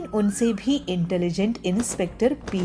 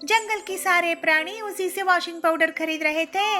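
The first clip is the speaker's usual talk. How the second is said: put-on high chirpy young wife voice, much higher; high daughter-in-law voice; young bird daughter-in-law; 10 semitones higher than usual. A narrator's reading. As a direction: show off the bargains brightly.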